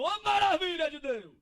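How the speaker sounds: brado alto, agudo e arrastado; grito de dentro. Acted. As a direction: grite louvando lá de dentro. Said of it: "gritou lá de dentro". (grito de louvor encenado)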